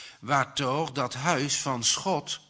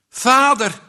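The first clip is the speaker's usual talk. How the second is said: toonloos roepen; 11 semitones higher, 10 dB louder than usual. A narrator's reading. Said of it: "roept hij toonloos".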